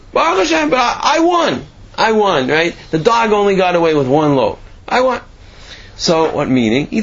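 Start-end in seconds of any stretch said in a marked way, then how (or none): 0.1-5.2 smug triumph. Acted. Baker gloats over winning.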